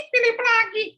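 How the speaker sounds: put-on high childish whine, repeated; sulky boy. Philip complaining to his mother about errands, high whiny child voice.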